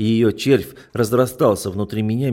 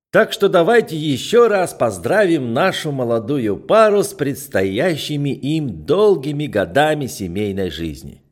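tipsy toast; 5 semitones higher, 5 dB louder than usual. Acted. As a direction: raise the glass, tipsy and loud. Drunk uncle's long toast.

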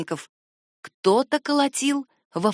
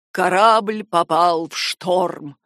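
slow, heavy, croaky voice; horrified lord; 3 semitones lower, 3 dB louder, much slower than usual. A narrator's reading. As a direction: force out the dreadful news slowly. Lord recounts shipwreck in horror. (медленный мрачный голос лорда)

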